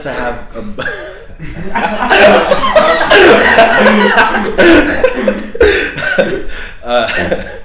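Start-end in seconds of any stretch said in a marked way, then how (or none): 0.9-6.8 long high laughter. Real, not acted. Teased about Silas.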